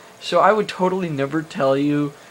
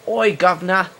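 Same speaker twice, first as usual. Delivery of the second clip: hammed-up British "oi, guv'nor" accent, pitched up; bad British accent; 4 semitones higher, 3 dB louder than usual. Shown as a demonstration. Answering whether he can do a British accent, bad British accent. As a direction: do a terrible mock British accent. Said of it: "Can I do a British accent?".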